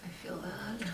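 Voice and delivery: hushed whisper; nervous night vlog whisper. Real, not acted. Quiet reaction in ghost hunt.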